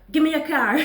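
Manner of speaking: imitating a carjacker's loud demand; retold demand. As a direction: bark the order like the carjacker. Robber demands her car.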